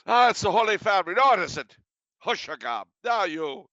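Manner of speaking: high, loud mock bickering; Irish brawlers. Irish crowd arguing over a vision, hammed-up quarrel. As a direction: play a crowd starting to squabble.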